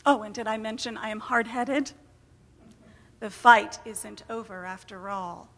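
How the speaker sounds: plain reading voice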